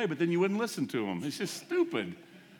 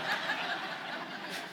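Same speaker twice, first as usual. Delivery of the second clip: soft, airless laugh that shakes out without voice; breathless silent laugh; 4 dB quieter than usual. Real, not acted. Preacher laughs at his own dark fear, breathless and silent. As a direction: laugh helplessly at the absurd thought.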